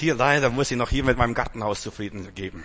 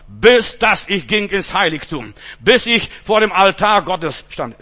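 solemn preaching; 5 semitones higher, 8 dB louder, slower than usual. Acted. Solemn scripture-like recital.

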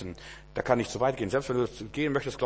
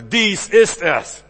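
slow, loud and higher, a proclaiming Spirit; solemn divine voice; 7 semitones higher, 11 dB louder, much slower than usual. Acted. Holy Spirit to prophet Samuel, slow solemn proclamation. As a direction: announce it like the voice of God. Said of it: "sagt der Heilige Geist zum Prophet Samuel".

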